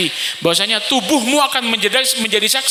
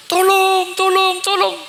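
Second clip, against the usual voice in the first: much higher, desperate cries; screaming for help; 9 semitones higher, slower than usual. Acted. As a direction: scream for help as you are pushed into hell. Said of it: "Suara menjerit".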